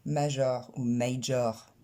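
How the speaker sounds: major said French way, maʒɔʀ with rolled r; imitating French major; wrong French major. Teacher mimics learners' wrong major, slow. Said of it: "Et non pas".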